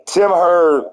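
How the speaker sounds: ordinary talk, nothing marked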